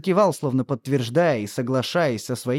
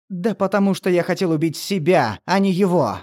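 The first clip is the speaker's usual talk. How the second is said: exploding in anger, higher and louder; angry outburst; 5 semitones higher, 4 dB louder than usual. Acted. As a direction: blurt it out in sudden fury. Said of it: "Взорвался Томас".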